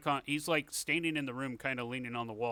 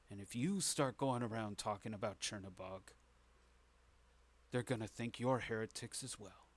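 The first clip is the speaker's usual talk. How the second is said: hushed, halting conspirator's warning; Itamir, grave and secretive; 5 dB quieter than usual. Acted. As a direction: lean in and warn them softly, with weighty pauses. Game master voices Itamir's warning.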